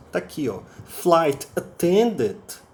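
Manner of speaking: ordinary delivery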